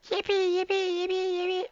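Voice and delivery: high tiny creature cheer; squeaky yippee. Pretend.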